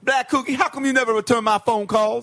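high nagging voice; mock scolding lover; complaining girlfriend.

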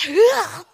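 drawn-out high, loud groan; acted frustrated cry. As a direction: let out a cry of private frustration. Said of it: "겉으로 못했죠".

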